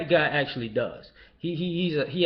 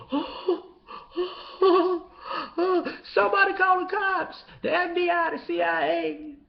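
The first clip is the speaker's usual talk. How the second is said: much higher shrieking alarm; hammed-up panic; 14 semitones higher than usual. People mocked as panicking when he fights back, high shrieking. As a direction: squeal for help like a scared victim.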